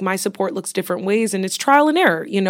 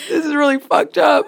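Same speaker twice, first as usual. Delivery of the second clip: laughing through tears; 8 semitones higher, 7 dB louder than usual. Real, not acted. Joke while crying.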